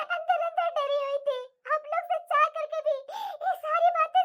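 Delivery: sobbing with relief; tearful gratitude. Acted. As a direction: give thanks through tears. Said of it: "तुम रो मत". (रोते हुए आभार जताकर)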